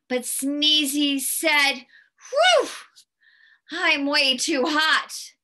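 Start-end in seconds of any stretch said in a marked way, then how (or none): 2.3-5.3 overheated snowman. A narrator's reading. Snowman too hot in his clothes, loud mock complaint. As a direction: puff out a big whew and complain.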